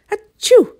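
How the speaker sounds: big theatrical sneeze; loud sneeze. Acted. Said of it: "Somebody sneezed".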